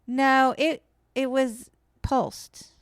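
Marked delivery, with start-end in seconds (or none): none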